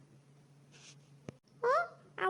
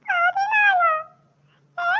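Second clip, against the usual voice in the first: much higher, loud, stunned squawk; shocked disbelief; 10 semitones higher, 4 dB louder, much slower than usual. Acted. Student to teacher on hearing fourteen marks, shocked shriek. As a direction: shriek the number back in disbelief. Student hears his low mark.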